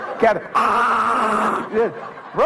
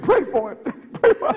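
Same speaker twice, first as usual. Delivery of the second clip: pleading wife; 8 semitones higher than usual. Retold or imitated. Wife pleading in retold scene.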